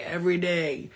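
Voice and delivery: ordinary talk, nothing marked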